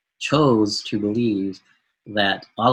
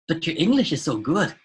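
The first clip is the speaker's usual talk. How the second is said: higher, put-on voice of a surprised stranger; imitated collector; 7 semitones higher than usual. Collector to the American student at the door, mimicked in a higher voice. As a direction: mimic a stranger amazed at your English. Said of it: "And he goes".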